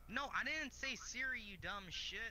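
high, slow, exasperated yell at the phone; annoyed yell. Real, not acted. Gamer yells at his phone for answering Siri, high and exasperated. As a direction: snap at the phone for mishearing. Yelling at the voice assistant.

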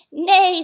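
loud, high drawn-out neigh; whinny the word out like a horse. A narrator's reading. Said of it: "said a horse".

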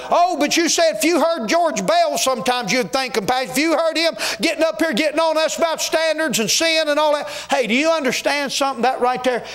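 impassioned Southern preacher in full flow; fervent preaching. Real, not acted. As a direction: challenge the congregation with rising fervor. Preacher challenging the congregation.

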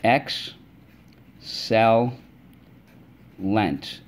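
"Excel-lent" split slowly into Ex-cel-lent; syllable by syllable. Shown as a demonstration. Teacher to class, tapping syllables slowly on fingers. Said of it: "Let's go".

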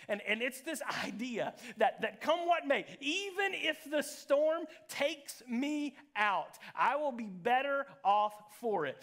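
high, loud preaching at full force. Real, not acted. Preacher proclaiming the promise to the congregation, impassioned. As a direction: drive it home with rising, emphatic force.

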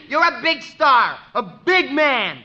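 slow, hurt sarcasm; hammed-up pouting; mock sulking. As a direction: drag it out like a wounded kid. [name] sulks, mock self-pity.

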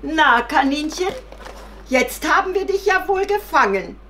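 loud, high-pitched jeer at a captive; mocking, gloating. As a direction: gloat over the caught rabbit. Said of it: "da lachten sie". (höhnisch triumphierend)

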